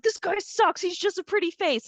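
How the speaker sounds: high-pitched mock indignation; scornful, exaggerated quote of yourself; retold scorn. Retold old scorn for actor.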